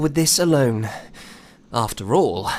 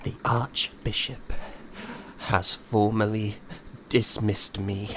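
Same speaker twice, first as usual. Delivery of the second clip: low, hushed confession, voice dropping; ashamed, barely getting the words out; shaken, hushed; 5 semitones lower, 8 dB quieter than usual. Acted.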